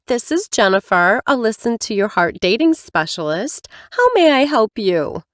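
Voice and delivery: lightly voiced chipper receptionist; bright professional phone greeting, lightly voiced; dating specialist.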